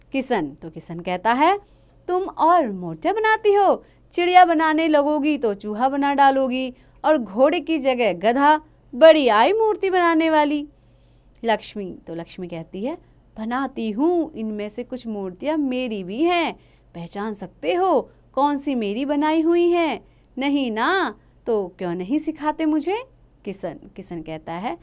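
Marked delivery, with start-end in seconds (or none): 2.1-10.7 louder, scornful teasing; mocking father. Father ridicules his daughter's sculpting, mocking. 13.4-23.0 put-on a light girl's voice daring him; challenging girl. Daughter challenges father to spot her work, lightly defiant.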